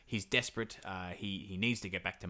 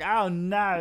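mock dismay; 9 semitones higher, 7 dB louder than usual. Ivan loses the mares.

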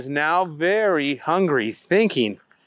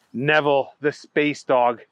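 usual speaking voice

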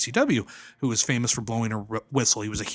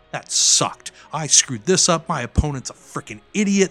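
loud, higher-pitched inner rant; frustrated venting; 5 semitones higher, 8 dB louder than usual. Acted. Wrestler privately fuming about a bad match, frustrated venting. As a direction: vent hard about a botched match. Voicing angry inner thoughts.

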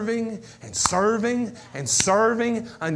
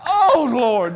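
cry out; 3 semitones higher, 9 dB louder than usual. Shown as a demonstration. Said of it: "that crying out".

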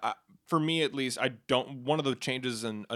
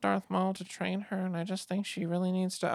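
imitating George Lucas; 6 semitones higher than usual. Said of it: "he was like".

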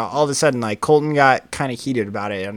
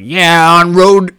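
mocking quote; 4 semitones higher, 12 dB louder, much slower than usual. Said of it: "they're like".

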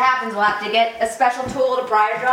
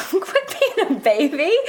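laughing; 6 semitones higher, slower than usual. Acted. Teasing him while laughing.

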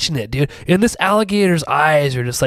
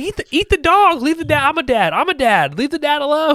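much higher, hammed-up pleading to the alligator; mock pleading; 9 semitones higher than usual. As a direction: beg the monster in a squeaky voice, half-joking.

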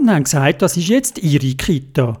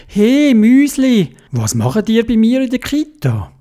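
calling out; 8 semitones higher than usual. Acted. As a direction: call out across the room. Calling out to mice.

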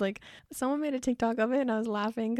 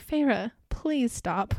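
very slow, drawn-out begging; hammed-up weary plea; slow mock plea; 2 semitones higher, much slower than usual. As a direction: stretch it out like an exhausted fan. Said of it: "And I'm like".

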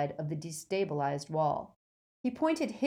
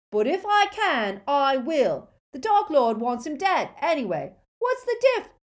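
high, loud defiant rant; rising yell that gets cut off; 9 semitones higher, 8 dB louder than usual. A narrator's reading. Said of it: "yelled Crabbe".

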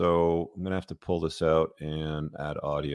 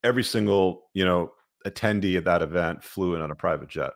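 normal talking voice

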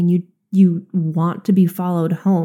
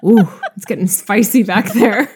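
laughing; 3 semitones higher, 5 dB louder than usual. Real, not acted. Teasing friend about pole comment.

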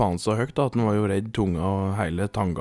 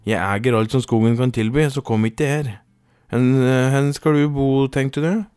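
loud, raised, bossy retort; bossy challenge; 5 semitones higher, 5 dB louder than usual. A narrator's reading. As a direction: lay down who owns the place, then demand an answer. Ragna quarrels with the duke. (høy, myndig utfordring)

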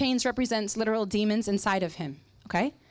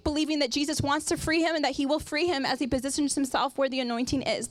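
ordinary talk, nothing marked

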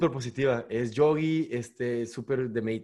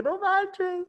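shouting in the park. Retold or imitated. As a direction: shout it out with relief. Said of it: "Gritando así en el parque". (gritando eufórico, muy agudo)

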